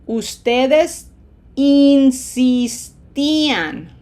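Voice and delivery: insistía very slow and loud, three long "ee" vowels; smiled long I's. Shown as a demonstration. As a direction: smile through een-sees-tee in every insistía form. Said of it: "be ready to smile three times".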